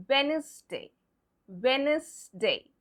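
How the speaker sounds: Wednesday without the D, WENZ-day; correct silent-D pronunciation. Shown as a demonstration. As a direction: say Wednesday carefully, leaving the first D silent. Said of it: "We pronounce it".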